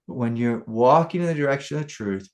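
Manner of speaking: ordinary talk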